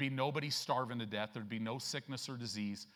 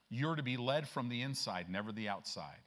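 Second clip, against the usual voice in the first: ordinary talk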